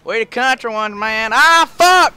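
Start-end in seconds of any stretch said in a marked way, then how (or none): 1.3-2.1 high, loud burst of swearing; startled yell. Real, not acted. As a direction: yell as it goes wrong. Sudden crash outburst.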